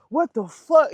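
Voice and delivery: retold disbelief, pitched high; high, stunned outburst; waking from passing out, high and stunned; shocked. Passing out after a shower.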